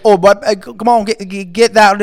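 loud, garbled Cajun impression; mock an unintelligible Cajun; high, loud Cajun jabber; Cajun accent. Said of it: "you can't understand what they're saying".

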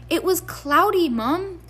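high, cheeky protest; cheeky teen boy. Acted. Fred excusing himself.

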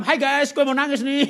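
loud, high fake vlog greeting; hammed-up livestreamer voice; mock vlogger. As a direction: announce it like a cheesy influencer. Mocks filming oneself crying.

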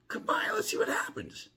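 high whispered taunt. Retold or imitated. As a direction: tease him with a whisper. Taunting the man who threatened him.

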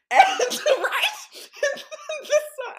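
high laughter through words; bursting into laughter mid-story; laughing. Real, not acted.

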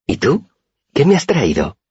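very high, eager little voice; Auri's voice. A narrator's reading. Girl asks what gift her friend brought, high voice. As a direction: ask back in a small high voice. Auri asks back in character voice.

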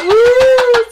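high, loud comic whoop of fright; mock startled scream. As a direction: squeal as if jumped by a prank. Acting startled viewer's scream.

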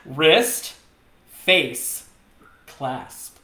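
one posed word at a time; watch-modeling demo. Shown as a demonstration. Showing co-host how to model a watch, posed demo. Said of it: "This is how you model a watch".

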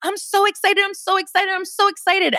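high-pitched, excited chant to yourself; excited self-talk. Acted. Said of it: "trick your mind, biohack your mind and be like".

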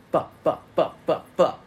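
mock tantrum of curses; frustrated cursing. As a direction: spit out each fuck in frustration. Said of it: "wake up and say".

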